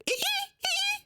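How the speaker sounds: high squeaky dolphin call; dolphin noise. Acted. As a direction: chirp like a dolphin. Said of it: "I was just doing a dolphin impression".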